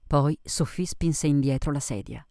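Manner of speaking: ordinary delivery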